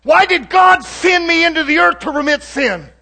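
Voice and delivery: high, loud, shouting sermon delivery; preaching at full force. Acted. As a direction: preach it out to the whole congregation. Preacher rises again.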